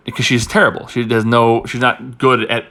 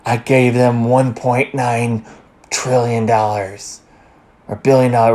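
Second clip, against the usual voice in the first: slow, drawn-out mock creepy Biden; creepy Joe voice; slower than usual. Mocking the president's boast, slow mild creepy voice. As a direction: stretch the numbers like a lightly creepy old man. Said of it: "you said in a creepy voice".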